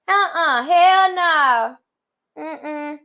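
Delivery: high, loud "hell no" with full attitude; emphatic refusal. Acted. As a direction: shut the idea down, sassy. Rejecting settling for enough.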